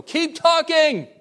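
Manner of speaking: high, loud call from the back of the room; yelling encouragement. Retold or imitated. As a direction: yell it out to egg the speaker on. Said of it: "They'll yell from the back".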